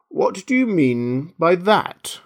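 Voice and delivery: slow, high-pitched stern caterpillar. A narrator's reading. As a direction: demand it slowly, word by word. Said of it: "said the caterpillar sternly".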